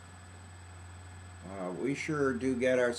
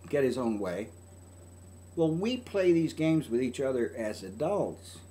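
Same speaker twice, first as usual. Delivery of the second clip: normal talking voice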